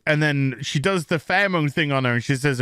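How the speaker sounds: ordinary talk, nothing marked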